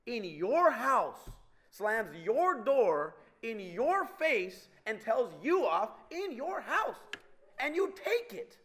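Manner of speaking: slow, high build, punching every "your"; drawn-out, emphatic outrage leaning on "your"; indignant stress on "your". Real, not acted. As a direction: hammer "your" and "you" in mounting disbelief. Indignant build about child's defiance.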